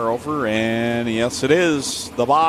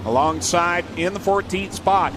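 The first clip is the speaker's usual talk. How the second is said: announcer; 5 semitones higher than usual. Real, not acted. Reads the starting lineup.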